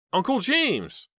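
high, loud shocked exclamation; shocked cry. Acted. Heather sees her angry uncle at the car window, shocked cry. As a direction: gasp out his name in alarm.

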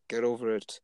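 ordinary delivery

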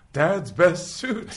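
loud, high, drawn-out call; mock proud announcement. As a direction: announce it slowly and loudly, pitched high. Acting out the episode's character.

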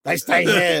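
a craving junkie guarding his stash; a hint of a crack addict. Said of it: "like a crack addict".

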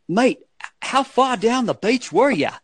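high-pitched Australian impersonation; Australian accent. Retold or imitated. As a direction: put on a broad, high Aussie accent. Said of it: "that's my Australian accent".

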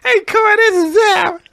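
high squeaky voice, cracking; high squeaky take. Pretend. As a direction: pitch it way up as a joke. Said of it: "Nah, I don't like that one".

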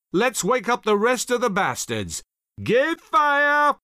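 building to a high shouted command; gleeful officer barking the order to fire. A narrator's reading. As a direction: rouse the gunners, then yell to fire. Knox orders guns to fire.

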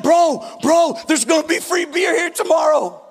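high, eager mock shout to a friend; excited college kid. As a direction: hype up your bro with big news. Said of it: "he told his friend".

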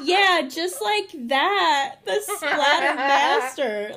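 slow, sultry mock hype; sexual. Teasing her co-host by hamming up his line, slow and sultry. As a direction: drag it out like a seductive come-on. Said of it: "you said it really sexually".

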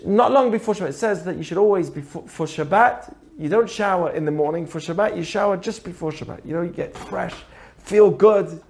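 ordinary talk

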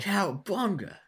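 a hint of a cartoon party-dude catchphrase; Michelangelo impression. Chiming in about Ninja Turtles, light Michelangelo impression. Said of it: "is also Michelangelo in the two thousand three".

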